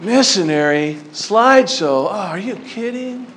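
put-on soft, dismissive teenage scoff; a mild mock of a bored kid. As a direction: roll your eyes through it. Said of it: "I'd be like".

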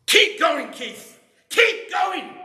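sudden, very high, loud shout; Brian's sudden shouted last words to Keith; imitated shout. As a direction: bellow it out like a dying man's last burst of urging. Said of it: "I'm gonna shout".

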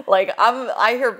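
ordinary talk, nothing marked

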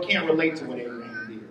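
murmured confession; hushed repeat. Shown as a demonstration. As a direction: drop it to a low, soft aside. Repeated line, second take hushed.

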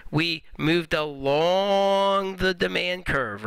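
slow, leaning on along; slow emphatic. Shown as a demonstration. Teacher stressing the movement along the curve, slow. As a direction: stretch out the key phrase. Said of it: "slow emphatic teaching point".

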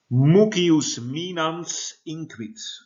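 high, very slow, drawn-out Latin recitation; reading Latin aloud. Real, not acted. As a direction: stretch out each Latin word for the class. Teacher reads Latin text aloud.

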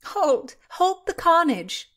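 high, loud mock-alarmed cry; hammed-up shout to stop the fight; amused cry. A narrator's reading. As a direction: call a halt in playful horror. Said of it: "cried Constance, laughing".

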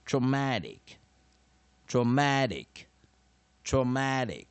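loud "traumatic" with strong stress on "mat"; model pronunciation. Shown as a demonstration. Teacher finishing the syllable drill, loud traumatic stressed on "mat". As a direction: drill "traumatic" emphatically, pausing after each.

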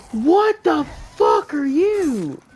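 horrified yell at a monster; shocked shout. Real, not acted. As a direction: blurt it out in disbelief. New monster appears.

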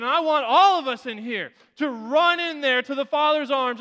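fervent preaching; impassioned preaching. Acted. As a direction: preach it to the back row. Preaching at full force.

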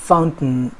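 "फाउंटेन" as fount'n, syllabic n; actual native pronunciation. Shown as a demonstration. Teacher repeating the actual form, "फाउंटेन" as fount'n. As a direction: drop the last vowel of "फाउंटेन". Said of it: "actual pronunciation की बात करें".

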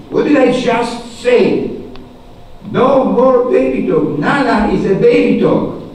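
high, loud teacher's reprimand; acted exasperated scolding. Teacher forbidding Sally baby talk, exasperated mock scolding.

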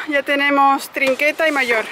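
usual speaking voice

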